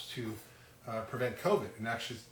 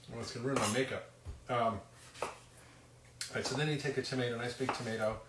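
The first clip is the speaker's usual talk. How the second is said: usual speaking voice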